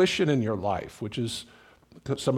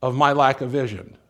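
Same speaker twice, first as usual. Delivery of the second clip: prayer; slower than usual. Shown as a demonstration. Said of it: "One of the prayers you can pray is".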